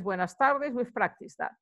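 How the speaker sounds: normal talking voice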